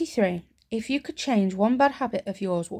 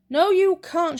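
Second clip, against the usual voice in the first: higher, louder mock firm refusal; firm mum refusal; 10 semitones higher, 5 dB louder, slower than usual. Mum refusing her child on Christmas morning, mock firm. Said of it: "she said".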